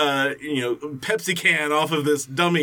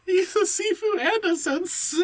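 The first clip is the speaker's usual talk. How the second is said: mock high voice. Mocking the ad's boast.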